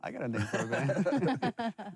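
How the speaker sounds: tossing off the idea with a chuckle; proud dad, laughing through the line; amused quote. Retold or imitated. Joking about daughter naming band.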